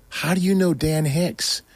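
high, slow, wide-eyed question; amazed friends. Retold or imitated. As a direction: stretch it out in disbelief.

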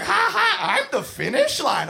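put-on high sing-out child voice; giggly, oblivious kid boast; gleeful kid. Child lying in the skaters' path, gleeful high voice.

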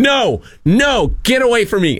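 pushing the scammer away, mild mock yell. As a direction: retell the yell with pitch, not volume. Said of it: "And I'm yelling".

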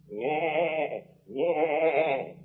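feeble, soft imitated bleat; goat bleat imitation. Shown as a demonstration. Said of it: "make a noise as fine as that".

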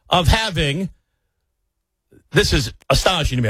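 ordinary talk

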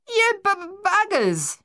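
very high, outraged yell; stuttering yell. A narrator's reading. Luther yelling at the retreating men, high stammered shout. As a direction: catch on the B, then yell it out. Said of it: "Luther yelled at the retreating men".

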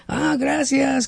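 a lawyer thanking softly, lightly voiced; mild imitation. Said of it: "le dice".

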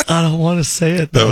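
replaying her hesitant line with a half laugh; amused replay of the cashier's refusal; repeating the cashier's line. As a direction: echo her reluctance, amused. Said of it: "That's what she says".

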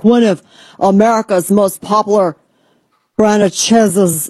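half-joking fake voice for a news quote; odd put-on voice. Reading out an outraged post in a lightly put-on voice. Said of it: "I don't know why I'm talking like this".

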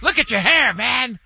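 high-pitched mock dad outburst; hammed-up parental disgust; imitated ranting dad. Son acting out his dad, high mock disgust.

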